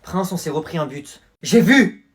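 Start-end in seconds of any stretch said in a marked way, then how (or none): 1.4-2.1 sudden loud, exasperated retort; acted burst of irritation; irritated outburst. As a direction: cut in loudly, fed up. Prince snaps at goal report.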